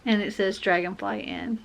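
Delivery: ordinary talk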